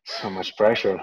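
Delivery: nervous laugh through the words. Real, not acted. Co-host given the floor first, laughs it off nervously.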